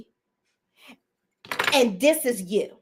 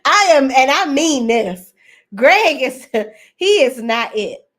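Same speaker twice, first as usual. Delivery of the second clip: loud, laughing through the words; can't keep a straight face; 3 semitones higher, 7 dB louder than usual. Real, not acted. Laughing through her verdict.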